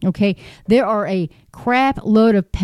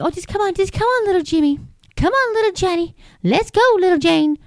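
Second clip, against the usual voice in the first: mocking sing-song parent; 8 semitones higher, faster than usual. Mocking parents who lead kids to drag shows, high coaxing voice. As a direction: coax a child in a sugary high voice to ridicule it. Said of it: "And they think".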